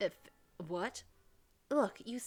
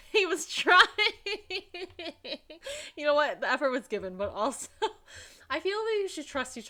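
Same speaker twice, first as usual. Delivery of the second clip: loud, higher, laughing through the words; real laughing; 7 semitones higher, 8 dB louder than usual. Real, not acted. Player laughing at Emil's accent attempt, loud laughter. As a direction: tease between giggles.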